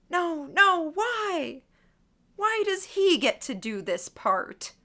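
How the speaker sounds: high, loud wail of complaint; screaming inwardly. A narrator's reading. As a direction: wail in indignant disbelief. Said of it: "I screamed in my mind".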